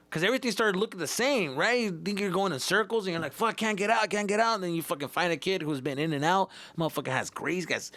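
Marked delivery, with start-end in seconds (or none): 3.3-4.5 higher, louder panicky mutter; mock a scared kid stuck in a maze. Panicked kid lost in tunnels.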